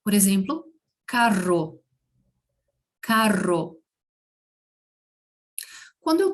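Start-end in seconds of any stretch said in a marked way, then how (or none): 1.1-3.8 carro with a trilled double rr; rolled r. Shown as a demonstration. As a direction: roll the rr in carro strongly. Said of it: "eu também vou vibrar".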